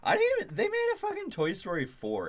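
high, loud exasperated squeal; exasperated outburst. Real, not acted. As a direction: blurt it out in disbelief.

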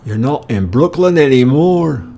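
Irish guy on the corner taunts the American; Dublin lad's taunt. Retold or imitated. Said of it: "one of them says".

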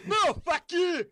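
high shrieked fuck you; high comic yell; mock angry yell. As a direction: squawk it back like an angry alter. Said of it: "He's like".

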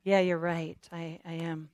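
low, fading surrender; quiet resigned admission; defeated agreement. Acted. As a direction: give in softly, voice sinking. Acts out giving in to the enemy.

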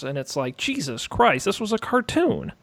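ordinary talk

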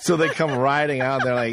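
usual speaking voice

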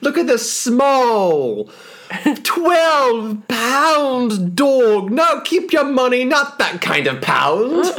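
high, slow proclaiming crier; town crier. Pretend. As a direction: hear ye, bellow the royal news. Announcing the queen's tiny dog.

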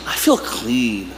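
slow, low and soft, savouring it; contented. Retold or imitated. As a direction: sigh it out, slow and satisfied. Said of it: "you're like".

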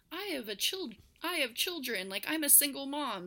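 high, lightly voiced robot mom; a hint of a pleading robot. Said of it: "So it's like a robot telling them".

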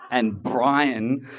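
usual speaking voice

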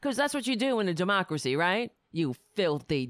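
ordinary talk, nothing marked

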